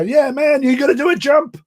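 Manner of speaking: high-pitched mock American enthusiasm; hyped-up American. Mimicking an American urging him to jump, hyped-up. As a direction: egg him on like an excitable thrill-seeker. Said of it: "like, really hyped up".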